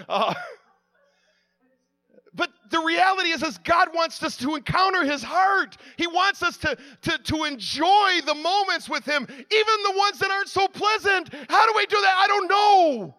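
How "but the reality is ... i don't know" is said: full-force preaching. Acted. Preacher rousing the congregation toward God's heart, full-force preaching. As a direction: preach it out with a building shout.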